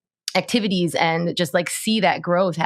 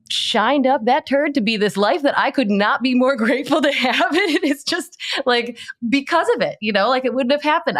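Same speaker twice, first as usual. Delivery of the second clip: high, laughing through the words; tickled by the turd line; real giggly and higher; 8 semitones higher than usual. Real, not acted. Laughing at his own turd phrase.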